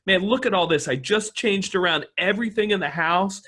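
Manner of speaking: mock delight at rearranging the house; excited wife, imitated. Voicing an excited wife coming home.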